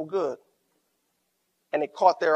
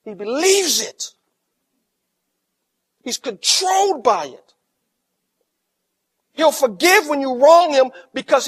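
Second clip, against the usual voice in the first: higher, louder, slow building sermon climax; preaching at full force; 7 semitones higher, 9 dB louder than usual. Acted. Pastor urging the church to forgive, rising and loud. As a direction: build the sermon to a ringing peak.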